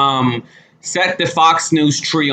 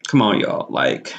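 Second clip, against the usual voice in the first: usual speaking voice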